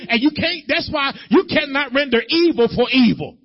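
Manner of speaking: high, loud, emphatic exhortation; full-force preaching. Real, not acted. Preacher warning that only Jesus can hurt demons, forceful preaching. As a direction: hammer each phrase at the congregation.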